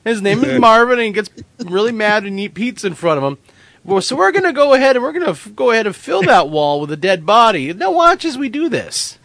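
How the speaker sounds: TV host voice. Pretend. Mock host bit continues.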